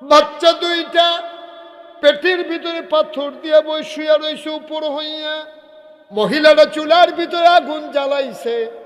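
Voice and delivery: high, loud storytelling shout; loud high-pitched pulpit cry; full-force preaching. Acted. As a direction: narrate it at the top of the voice.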